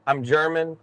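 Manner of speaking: ordinary delivery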